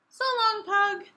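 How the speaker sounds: high sing-song goodbye; cheery farewell. Acted. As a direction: wave him off brightly. Parting farewell in story.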